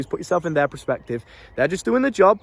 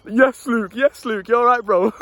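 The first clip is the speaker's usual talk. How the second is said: a casual shout-out from a passing mate, a bit louder; lads greeting; 6 semitones higher, 4 dB louder, slower than usual. Retold or imitated. Lads from the estate greet Luke in passing, casual loud hail. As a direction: hail him like a lad from the estate walking by. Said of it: "lads going past that knew me".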